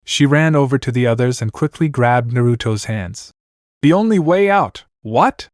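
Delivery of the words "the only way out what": high-pitched outcry, volume held back; understated shout of alarm; mild shout. A narrator's reading. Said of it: "they all shouted at the same time".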